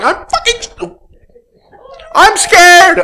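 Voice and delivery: high, loud mock panic; scared ego. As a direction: voice the ego shrieking in terror. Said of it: "it's the ego going".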